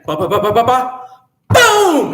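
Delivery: bumping ba-ba-ba then a high loud boom; noise imitation. Storyteller imitating the noise upstairs, rising to a loud boom. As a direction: mimic footsteps then a crash.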